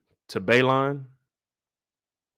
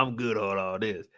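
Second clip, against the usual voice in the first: indifferent; 7 semitones lower, 7 dB quieter than usual. Retold or imitated. Damon declining, disinterested.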